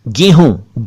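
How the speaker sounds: "गेहूं" as GEY-hoon, nasal end; correct close vowel. Shown as a demonstration. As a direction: say "गेहूं" with a narrow ay. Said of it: "गेहूं नहीं".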